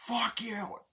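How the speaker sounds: high, loud mock snarl; mock angry shout. Nicolas Cage's character cursing the man, angry. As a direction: spit it at him. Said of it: "And he goes".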